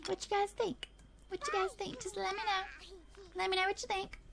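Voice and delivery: high, soft, cutesy plea; cutesy coaxing. Real, not acted. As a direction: coax the audience sweetly in a high, small voice. Coaxing viewers in put-on voice.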